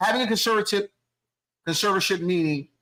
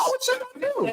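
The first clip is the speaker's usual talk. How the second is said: mock high voice. Mocking the son's denial.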